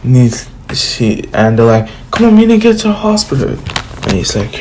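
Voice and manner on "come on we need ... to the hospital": fast, high, hurried urging; urgent. Retold or imitated. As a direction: rush them out the door. Urging Hinata to hospital.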